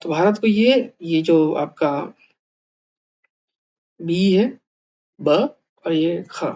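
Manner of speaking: normal talking voice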